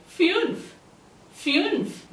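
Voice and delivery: fünf with a fronted "ew" vowel; umlaut U. Shown as a demonstration. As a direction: round the ü in fünf, close to "fyoonf". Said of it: "gives an U sound".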